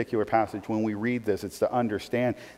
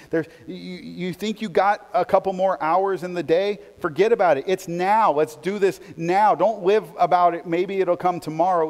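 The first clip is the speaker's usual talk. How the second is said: raised, urgent, driving; urgent preaching; 6 semitones higher, 4 dB louder than usual. Real, not acted. Pastor urging the congregation, urgent preaching. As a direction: press the church to act now.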